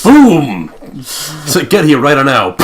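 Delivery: loud, high, slow boom of arrival; triumphant boom. Acted. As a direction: land it like a magic trick. Teleporting into office, triumphant.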